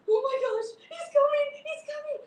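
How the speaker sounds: high, loud panicked alarm; panicked cry. Acted. Kids warning each other as the cat charges, panicked cry. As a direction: yelp it in panic.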